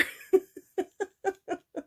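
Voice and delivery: high, short burst of laughter; real laughter. Real, not acted. Laughing while retelling the story.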